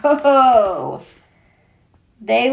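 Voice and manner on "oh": usual speaking voice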